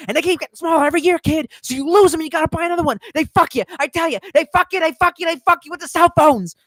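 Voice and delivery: quick high mock wiseguy rant; Joe Pesci impression. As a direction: fire it off like a fast-talking tough guy. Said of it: "And Joe Pesci's like".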